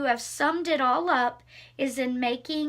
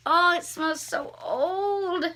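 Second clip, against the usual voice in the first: awed; 4 semitones higher, slower than usual. Real, not acted. Smelling the old pages.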